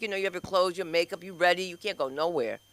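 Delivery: usual speaking voice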